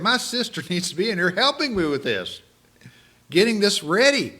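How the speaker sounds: mock exasperated complaint, rising high and loud; frustrated Martha. Martha complains to the Lord about her sister, exasperated. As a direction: play Martha fed up with her sister, a bit hammed-up.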